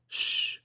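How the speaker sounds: long unvoiced shush; communal hushing. A narrator's reading. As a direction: shush them all. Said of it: "drawing another communal".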